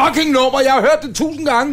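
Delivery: high-pitched, worked-up complaint; exasperated outburst. Real, not acted. Host defending himself to the singer about the song, exasperated. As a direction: protest in exasperation, voice jumping up high. Heated banter over a song.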